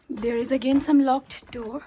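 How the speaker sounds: normal talking voice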